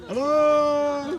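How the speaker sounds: loud high hello; calling out. Real, not acted. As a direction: call out across the valley.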